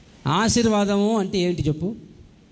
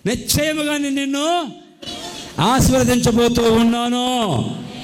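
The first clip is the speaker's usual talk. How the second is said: higher, building to a loud blessing; preacher swelling into the promise; rising proclamation; 4 semitones higher, 4 dB louder, slower than usual. Shown as a demonstration. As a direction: lift it up into a loud declaration. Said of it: "surely I will bless you".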